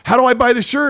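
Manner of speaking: high eager question from a customer; a hint of a keen buyer; quoted lady customer. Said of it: "She's like".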